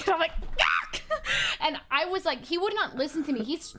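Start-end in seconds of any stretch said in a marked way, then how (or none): 0.6-1.0 mock retching yelp; disgusted shriek. Teller replaying reaction to partner's near-puking, disgusted outburst. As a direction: blurt out a short, harsh cry of disgust.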